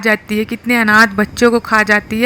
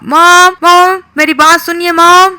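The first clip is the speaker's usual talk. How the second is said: put-on loud, higher kid yell for mom; calling mom loudly; 7 semitones higher, 6 dB louder than usual. Nicky yelling for his mom to tattle, loud high call. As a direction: shout for mom to come and listen.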